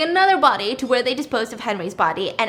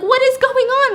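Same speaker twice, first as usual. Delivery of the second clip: high, loud retold shriek; hammed-up scream of disbelief; imitated screaming; 13 semitones higher, 8 dB louder than usual. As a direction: screech it like a panicked character. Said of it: "she's, like, screaming her face off".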